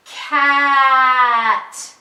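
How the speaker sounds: drawn-out sounds blended without a break; stretched slow blend. Shown as a demonstration. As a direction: stretch every sound but keep them joined. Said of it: "all together".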